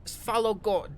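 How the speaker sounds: high, loud villain voice; drow giving directions. Acted. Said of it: "he, um, says that".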